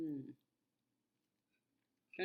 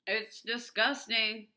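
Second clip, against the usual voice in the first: slow belched words; slow burp talk; 4 semitones higher, 9 dB louder, much slower than usual. Real, not acted. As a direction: drag it out as a burp. Burping while eating.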